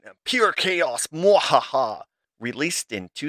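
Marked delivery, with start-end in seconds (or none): 0.0-1.1 mad scientist. Acted. Scripted villain gag before cackle. 1.1-2.0 evil mad scientist cackle; slow villain laugh, hammed up. Villain gag after chaos line.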